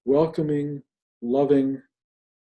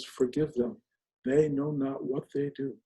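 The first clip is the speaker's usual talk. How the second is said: hushed quote; 7 dB quieter than usual. Retold or imitated. Quoting Jesus on the cross.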